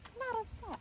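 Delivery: high-pitched mock protest; exaggerated high-voiced defensiveness; indignant retort. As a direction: squeak out an indignant denial. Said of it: "And I'm like".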